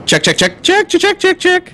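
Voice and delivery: mic check jumping in pitch; uneven auto gain. Real, not acted. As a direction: auto gain pumping the level. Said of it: "Your auto gain is going nuts".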